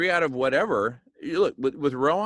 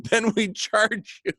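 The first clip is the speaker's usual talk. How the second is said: high, drawn-out punchline through laughter; gleeful mock-dealer punchline, slow and high; joking; 10 semitones higher, 4 dB louder, slower than usual. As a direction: squeeze the words out while laughing. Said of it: "I'm being funny".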